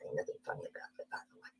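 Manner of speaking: very soft, quick, voiceless remark; disapproving aside. Real, not acted. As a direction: drop to a near whisper. Disapproving aside after reading aloud.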